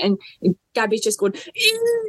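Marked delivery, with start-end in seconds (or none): 1.3-2.1 high excited squeak; mock-excited squeal. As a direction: squeal it like a thrilled friend. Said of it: "she was so excited".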